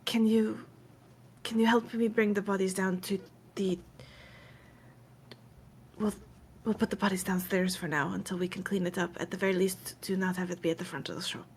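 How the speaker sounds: lightly voiced soft, halting request; a hint of a subdued, careful plea; hesitant Fawn asking. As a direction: ask quietly, feeling for the words. Game master voices Fawn asking for help.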